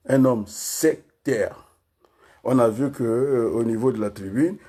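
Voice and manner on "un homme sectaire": slow, deliberate, separated syllables. Shown as a demonstration. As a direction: set each syllable down on its own. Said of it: "Je vais par syllabe".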